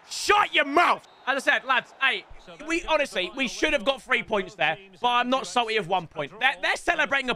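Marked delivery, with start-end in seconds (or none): 0.1-1.1 angry snap. Real, not acted. Snapping at the screen.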